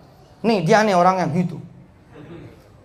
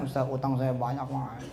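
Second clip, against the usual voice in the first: low, soft mumbled confession; dull complaint demo; 6 semitones lower, 10 dB quieter than usual. Shown as a demonstration. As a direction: make it small and unexciting, sinking away. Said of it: "Nggak seru ngomongnya". (pelan dan rendah, mengeluh tanpa semangat)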